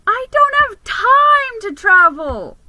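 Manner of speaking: very high, loud whine; squeaky protest. Acted. Overworked mouse insists he can't travel, high and loud.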